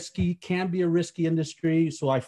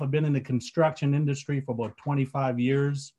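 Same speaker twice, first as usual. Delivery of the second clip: normal talking voice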